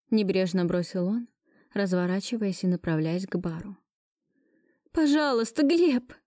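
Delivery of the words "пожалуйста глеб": high, rushing plea; desperate plea. Acted. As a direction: cry out after him. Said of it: "кинулась я ему вслед".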